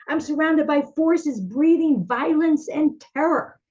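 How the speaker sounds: frightened plea. Acted. Psalmist crying out to an absent God, frightened. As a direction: plead with God in rising fear. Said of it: "The psalmist becomes so frightened".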